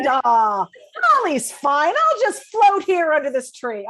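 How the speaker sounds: loud, high, hammed-up la-di-da; mock someone's carefree indifference at full volume; furious mockery. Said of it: "Oh, I was furious".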